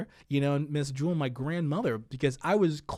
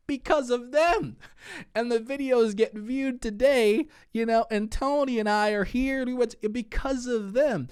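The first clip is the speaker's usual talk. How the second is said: raised voice, high and emphatic; impassioned; 10 semitones higher, 5 dB louder than usual. Real, not acted. Guest credits the couple who helped his family, impassioned. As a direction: drive it home loudly, slowing for weight.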